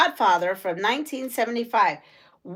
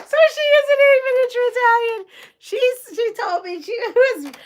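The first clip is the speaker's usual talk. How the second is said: squeaky high burst, fast and louder; surprised; 13 semitones higher, 5 dB louder, faster than usual. Real, not acted. Laughing at the news that the meatball lady was wrong, high and fast. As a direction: shoot way up in disbelief, rattling it off.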